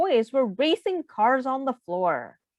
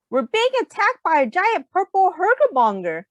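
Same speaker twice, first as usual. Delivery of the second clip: higher, louder hero cry; dramatic superhero alarm; 8 semitones higher, 4 dB louder than usual. Acted. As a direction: announce the attack like a superhero. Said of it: "superhero play".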